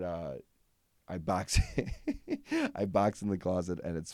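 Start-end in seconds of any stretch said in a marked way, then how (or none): 1.7-2.7 a burst of high giggling; squeaky giggle at own pun; high laugh. Real, not acted.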